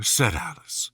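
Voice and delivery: ordinary delivery, nothing marked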